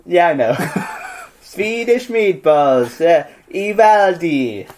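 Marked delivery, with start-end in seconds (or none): 1.4-4.7 slow, drawn-out mock Italian accent; a dwarf selling meatballs, in a slow Italian accent. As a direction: try Swedish, land in Italian again. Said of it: "Still Italian".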